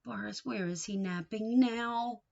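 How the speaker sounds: ordinary talk, nothing marked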